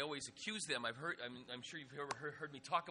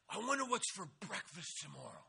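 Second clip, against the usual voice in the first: a put-on voice for the odd camper Donald; wistful, in a borrowed voice; dreamy cabin mate; 3 semitones higher, 5 dB louder than usual.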